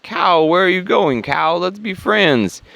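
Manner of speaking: high-pitched calling after a runaway friend; hammed-up, high friendly appeal; mock pleading character. Green guy calls after cow.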